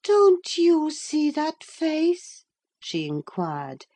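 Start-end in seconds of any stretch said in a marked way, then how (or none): 0.0-2.4 high, slow and earnest; earnest Catherine. A narrator's reading. Catherine staring at the mirror, high and slow. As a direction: ask it wide-eyed. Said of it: "gazing earnestly at the mirror".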